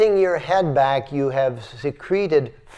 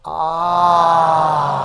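long open-throated ah, head tilted back; releasing ah with the head tipped back; 2 semitones higher, 4 dB louder than usual. Shown as a demonstration. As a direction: let the breath release in a long ah. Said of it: "Now do it again".

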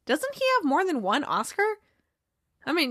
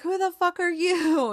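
mock heckle; 6 semitones higher than usual. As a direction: heckle the act in mock disbelief. Acted reaction to the announcement.

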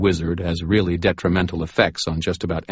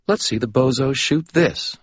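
put-on higher, smug young voice; cocky invisible Molly; 8 semitones higher than usual. A narrator's reading. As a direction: tease from nowhere. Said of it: "said her disembodied voice".

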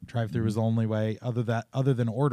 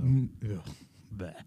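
disgusted noises; 2 semitones lower, 5 dB quieter than usual. Real, not acted. Man dismissing sushi to his co-host, disgusted. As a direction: grunt with mild revulsion at the food.

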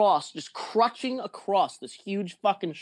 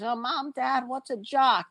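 high mock child voice; imitating a curious little kid, high; kid asking; 7 semitones higher, slower than usual. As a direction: kids asking mom and dad, lightly voiced. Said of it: "Little kids asking their mommy and daddies".

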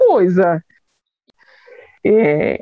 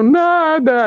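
high, drawn-out imitated whimper; mock tearful; 8 semitones higher than usual. Daughter crying before her father, tearful denial. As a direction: wail it as if crying. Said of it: "Chorava, chorava".

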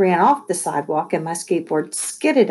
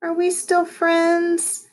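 high, drawn-out plea; pleading; 12 semitones higher, much slower than usual. A narrator's reading. Lexi to Caitlin at the door, pleading. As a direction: call it hopefully after someone leaving. Pleading after friend storms out.

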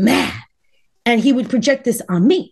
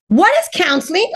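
baffled; 7 semitones higher than usual. Retold or imitated. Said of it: "I mean".

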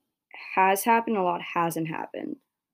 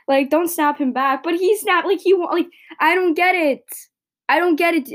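raised, exasperated rant; really fed up, voice climbing higher and louder; pissed off; 9 semitones higher, 6 dB louder than usual. Real, not acted. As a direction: vent the frustration loud and high. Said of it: "I sound so pissed off right now".

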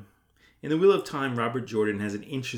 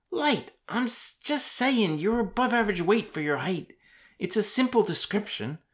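higher-pitched, protesting character voice; defensive young man; 12 semitones higher, 4 dB louder than usual. Acted. Rand excusing his chubby remark to Sam, defensive. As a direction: push it up high and justify yourself.

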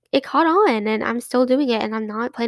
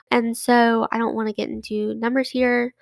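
ordinary talk, nothing marked

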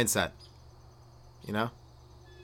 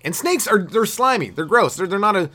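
ordinary delivery

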